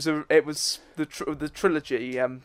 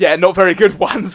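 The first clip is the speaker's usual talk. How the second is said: laughing; 3 semitones higher, 11 dB louder than usual. Real, not acted. Mocking own jokes.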